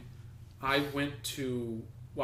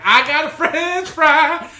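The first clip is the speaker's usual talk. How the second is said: much higher and louder, slow and delighted; gleeful costumed actor; 13 semitones higher, 13 dB louder, much slower than usual. Retold or imitated. Friend retelling the costumed actor who got a French fry, high and gleeful. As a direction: squeal it like a happy kid with a prize. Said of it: "skipping away with it".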